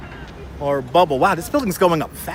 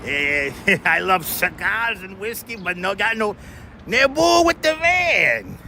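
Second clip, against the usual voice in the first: mock French accent; 2 semitones higher than usual. Streamer to chat, joking about the van man, mock French accent. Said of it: "Do you like my French accent?".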